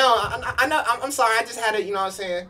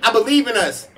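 rallying; 5 semitones higher, 6 dB louder than usual. Real, not acted. Rallying team after losing round.

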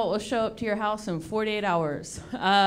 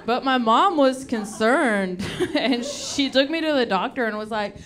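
normal talking voice

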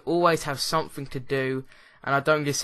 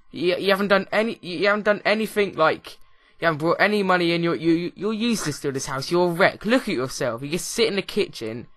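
fast, loud mock telling-off; hammed-up rant at a useless housemate; mock scolding; 3 semitones higher, 4 dB louder than usual. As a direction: scold the fridge like a lazy partner. Acted scolding of the fridge.

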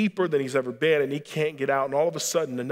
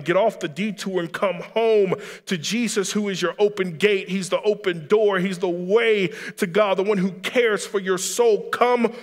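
raised, high, driving sermon climax; impassioned preaching; 7 semitones higher, 6 dB louder than usual. Real, not acted. Preacher calling the congregation to come home to Jesus, impassioned. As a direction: preach it with full force, urging them home.

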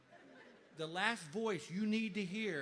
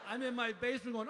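ordinary talk, nothing marked

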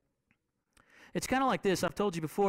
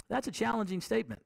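fast, quieter echo to let it sink in; quick, softer repeat; 3 dB quieter, faster than usual. Shown as a demonstration. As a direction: rush the repeat under your breath.

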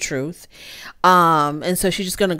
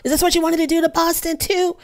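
mock yell; 10 semitones higher than usual. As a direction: mimic an outraged phone tirade up high, without real volume. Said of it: "she yells into the phone".

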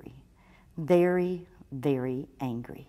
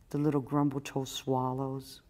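plain reading voice

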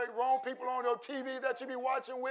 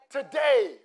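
loud declaration; 3 semitones higher, 10 dB louder than usual. Acted. Pastor hammering the point to the church, shouting. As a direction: punch it out loud and falling. Preacher repeats word, loud then soft.

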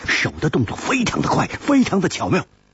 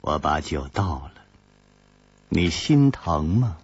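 teasing woman; 12 semitones lower, 4 dB quieter than usual. A narrator's reading. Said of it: "金灵芝眨着眼睛说".

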